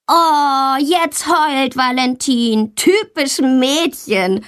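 slow, high sneering taunt; taunting boy. A narrator's reading. Boy mocking crying classmate, slow sneering taunt. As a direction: drag it out to mock her. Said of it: "ruft Tommy".

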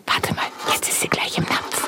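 breathless whisper. Acted. Yvonne watches the mouse at the bowl, whispering. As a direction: keep it down, the mouse is close.

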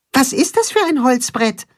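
hohe, piepsige Maulwurfstimme; kleiner Maulwurf. A narrator's reading. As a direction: frag neugierig wie ein winziger Maulwurf. (Maulwurf Max fragt, hohe kleine Stimme)

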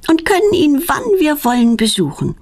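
mildly joyful, lifted pitch at first; happy. A narrator's reading. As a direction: share the good news with delight. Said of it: "freut sich Petronella".

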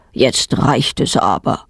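a father sighing that enough is enough; fed-up father. A narrator's reading. Bei jedem neuen Haustier sagt er immer.

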